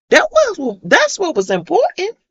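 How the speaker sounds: high, loud ringing declaration; emphatic declaration. Real, not acted. Mother telling the audience what truly mattered, emphatic. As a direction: proclaim it to the room. Preaching self-worth to audience.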